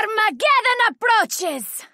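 very high, ringing proclamation; high battle cry. Acted. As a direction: hurl it out like a doom omen. Ultimate ability battle cry.

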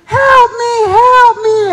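high, loud panicked yell; yelling for help. Acted. Official chased by a bull, yelling for help. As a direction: cry out as if being chased. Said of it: "you hear him yelling".

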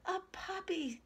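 put-on high-pitched child's squeal; delighted squeal. A narrator's reading. Cassie sees the new puppy, squealing. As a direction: squeal it like a thrilled little girl. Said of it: "Cassie squealed".

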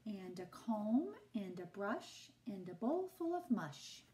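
ordinary delivery, nothing marked

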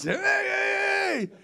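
high, loud hey called across the street; calling out. Retold or imitated. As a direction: shout hey to get their attention. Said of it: "a whole bunch of guys".

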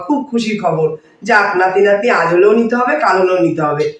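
ordinary talk

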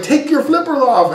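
high-pitched mock yell; imitated yelling. Everybody at the boat to the man in flippers, mock yelling. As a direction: call it out high, imitating an exasperated crowd. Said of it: "and Jamie's yelling".

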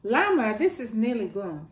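ordinary delivery

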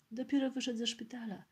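plain reading voice

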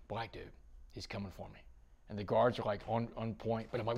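ordinary talk, nothing marked

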